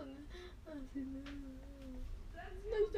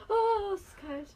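much higher, louder, slower oh, es ist kalt; startled yelp; 7 semitones higher, 11 dB louder, slower than usual. Real, not acted. Mask feels cold on her skin, a startled cry. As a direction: react to the cold shock.

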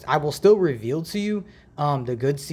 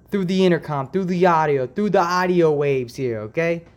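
higher-pitched hint of an Italian accent; accidental Italian accent; 4 semitones higher, 4 dB louder than usual. Real, not acted. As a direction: slip into a light Italian accent. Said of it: "I just got an Italian accent there".

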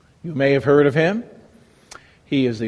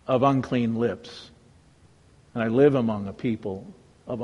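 soft, low confession of shame; quietly humbled, owning the guilt; hushed penitent lament; remorseful lament; 5 semitones lower, 7 dB quieter than usual. Acted. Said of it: "like Isaiah, they felt".

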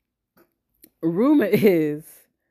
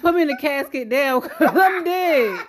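laughing; 5 semitones higher, 5 dB louder than usual. Retold or imitated. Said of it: "I would have been like".

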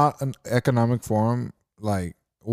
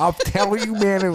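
high, hammed-up Southern drawl; mock Southern accent; 9 semitones higher, slower than usual. Friends joking about a Southern accent, mock Southern accent. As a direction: play a joking Southern guy. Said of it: "Imagine him with, like, a Southern accent".

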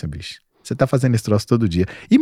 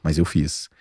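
quiet self-talk; 6 semitones lower, 5 dB quieter than usual. Retold or imitated. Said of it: "Eu preciso terminar o dia falando assim".

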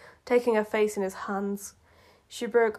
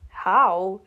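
ordinary talk, nothing marked

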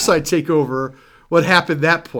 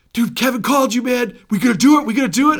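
higher, eager pushing; 8 semitones higher, faster than usual. Retold or imitated. Steve eagerly urging the singer to tour. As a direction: hype your friend up. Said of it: "He's like".

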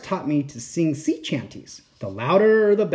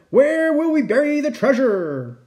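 Braidbeard hollering; 9 semitones higher than usual. A narrator's reading. Pirate worried about burying the treasure, high holler. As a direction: holler the question, pitch held high. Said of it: "hollered Braidbeard".

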